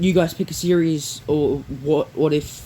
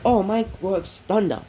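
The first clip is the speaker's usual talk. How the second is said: quoting a classmate, higher; 6 semitones higher than usual. Retold or imitated. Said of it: "He goes like".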